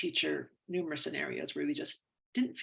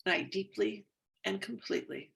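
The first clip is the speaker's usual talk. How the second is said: tapping affirmation. Acted. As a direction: read it like a calm self-help script, easing off at the end.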